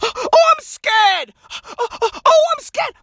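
high, whimpering mock fear; mocking whiny doctor. Comic mocking doctors complaining on TV, high whiny. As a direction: whine it like a scared baby. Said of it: "doctors are on T V every fucking day complaining".

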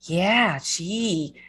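long round Minnesota vowels; put-on, drawn-out Minnesota accent. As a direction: mimic an empathetic Minnesotan listener. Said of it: "'cause they're doing that".

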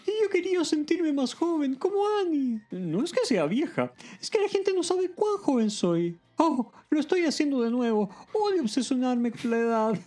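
high-pitched hammed-up female confession; emotional. A narrator's reading. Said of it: "Shirley también emocionada dice".

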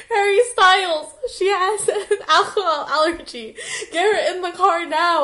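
hammed-up panicked yell through giggles; laughing scream. A narrator's reading. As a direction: yell the line but keep breaking into laughter. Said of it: "I yelled".

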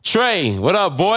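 high, loud hype greeting to a listener; hype shout-out. Real, not acted. Host hyping up a listener, high and loud. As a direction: call out to a friend with energy.

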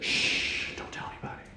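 whispered hush, almost no voice; mock whisper. Jesus hushing Peter, playful whisper. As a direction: shush like sharing a secret. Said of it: "And then Jesus says".

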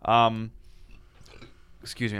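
talking between sips of coffee; mid-sip, mouth still wet with coffee; while sipping a drink. Real, not acted. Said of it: "I'm drinking coffee here".

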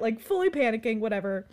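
usual speaking voice

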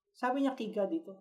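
ordinary talk, nothing marked

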